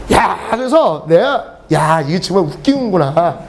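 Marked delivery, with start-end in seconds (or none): none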